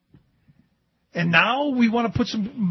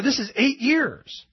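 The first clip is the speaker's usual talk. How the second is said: "eight years" slow and raised in pitch; stress on EIGHT YEARS; 6 semitones higher, slower than usual. Real, not acted. Host reminds listeners of the time span, "eight years" slow, emphatic. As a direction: draw out "eight years" to drive it home.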